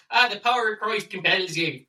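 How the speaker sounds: high, hammed-up Irish priest voice; Father Ted accent. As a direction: play it as a daft Irish priest from the sitcom. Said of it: "in a Father Ted accent".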